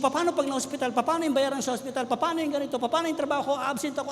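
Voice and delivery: voicing his panic, one question after another; anxious racing worries. (nag-aalalang sunod-sunod na tanong, mas mataas ang boses)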